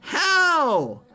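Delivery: high, loud wail of disbelief; anguished cry. Real, not acted. Streamer watching the race, wailing in dismay.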